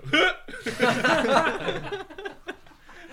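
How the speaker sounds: high, loud burst of laughter; real loud laughter at the memory. Retold or imitated. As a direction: crack up helplessly. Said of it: "you could hear me on camera go".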